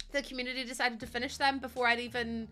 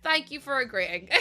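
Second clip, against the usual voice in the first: ordinary talk, nothing marked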